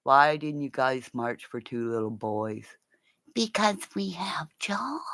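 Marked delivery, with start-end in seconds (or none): 3.3-5.0 mock whispered reply.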